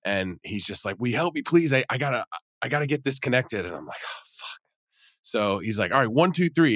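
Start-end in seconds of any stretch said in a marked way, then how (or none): 1.0-3.6 pleading, pitched up a little; urgent imitated begging; frantic plea. Stranger pleading with the jogger to hitch his trailer, urgent and pitched up. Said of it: "And he's so frantic". 4.0-4.6 whispered under the breath; a soft unvoiced curse of dread. Jogger dreading the heavy lift, whispering a curse. 5.9-6.7 frantic count. Retold or imitated. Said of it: "And he's so frantic".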